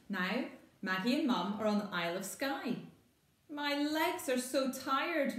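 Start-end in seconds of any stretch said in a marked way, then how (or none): 3.5-5.4 high, slow whiny complaint; grumbling. Acted. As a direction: moan about tired legs. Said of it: "Maggie grumbles".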